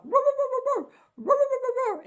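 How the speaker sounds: high, loud mock dog barks; playful dog-bark imitation; imitating barking.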